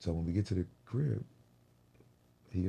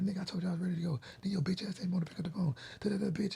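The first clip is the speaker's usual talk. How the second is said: much higher, nagging imitation of the girlfriend; mocking girlfriend rant; 14 semitones higher than usual. Man mimicking girlfriend's rant in the car, high nagging voice. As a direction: parody her yapping on and on.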